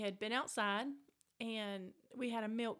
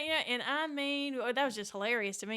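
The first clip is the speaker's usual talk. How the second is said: normal talking voice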